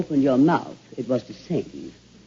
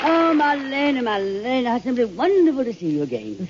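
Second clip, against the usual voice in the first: high, gushing theatrical greeting; a grande dame swooning over an old rival; gushing welcome; 8 semitones higher than usual. Acted. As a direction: lay the delight on thick, pitched high. Gushing greeting to [name].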